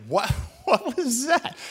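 Donna's stunned laughing question; a laughing what was that; laughing disbelief. Retold or imitated. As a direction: ask it through a laugh, slowly. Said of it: "Donna's like".

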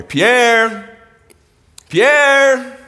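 high, loud call across the valley. Retold or imitated. Boy calling his lost dog from the porch, loud and high. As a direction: call the dog's name from the porch.

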